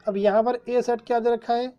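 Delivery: ordinary talk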